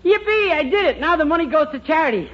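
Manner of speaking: gleeful cheer. Acted. Boy cheers losing the money.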